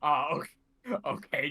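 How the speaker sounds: ordinary talk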